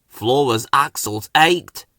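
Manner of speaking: plain reading voice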